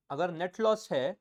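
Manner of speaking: ordinary talk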